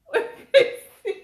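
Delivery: asking viewers mid-laugh; laughing squeal. Real, not acted.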